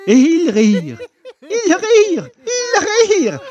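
laughter breaking through, rising high; laughing. A narrator's reading. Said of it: "finirent par éclater d'un grand rire". (en éclatant de rire)

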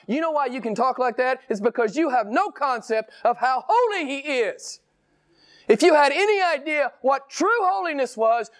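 high, urgent pulpit reproof; impassioned rebuke. Real, not acted. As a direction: rebuke the objector with a high, straining pitch. Rebukes the imagined objector.

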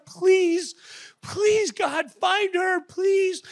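high, slow pleading prayer; desperate prayer. Retold or imitated. Mother praying for her lost daughter, high desperate plea. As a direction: beg God in tears. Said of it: "I prayed to God".

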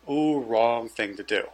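low, slow, quiet warning; wry ominous aside. Retold or imitated. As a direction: draw it out as a knowing aside. Wry aside as cop is provoked.

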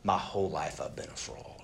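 soft whisper of a man breaking; a hushed, lightly voiced admission of shame; retold tearful confession. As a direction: let the confession slip out quietly. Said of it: "He starts crying".

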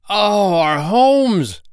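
high, slow, loud wail of alarm; panicked goblins. Acted. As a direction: cry out in horror, stretched. Goblins see fire.

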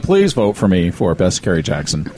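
low mock campaign plea; put-on deep candidate voice; Chris Van Oker. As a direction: drop low, pleading for votes in imitation. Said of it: "Chris Van Oker was like".

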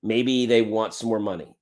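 usual speaking voice

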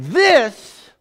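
high, loud furious outburst; mock angry teen. Voicing an angry seventeen-year-old daughter. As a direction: blurt it like a stomping teen. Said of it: "it was like".

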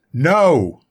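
exasperated narrated outburst; infuriated refusal. A narrator's reading. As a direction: refuse outright, pushed loud and high. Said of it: "Soen became infuriated".

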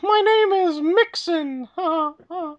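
very high slow squeaky mouse voice; high cartoon mouse voice. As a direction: introduce yourself as a cartoon mouse. Continuing Mickey voice.

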